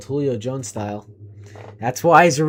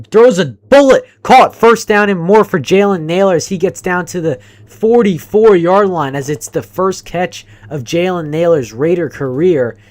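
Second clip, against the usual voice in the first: louder, excited live commentary; play-by-play call; 3 semitones higher, 8 dB louder than usual. Real, not acted. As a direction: call the throw as it happens.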